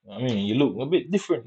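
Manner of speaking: usual speaking voice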